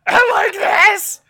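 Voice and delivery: high, drawn-out mock shriek; Wick's old voice. Cohost reads Wick's lines in his old voice, hammed-up high shout. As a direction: belt it out as an over-the-top cartoon villain. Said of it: "lines in his old voice".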